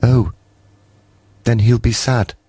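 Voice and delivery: high small saddened voice; sad creep. A narrator's reading. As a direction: mourn for Moomin Troll.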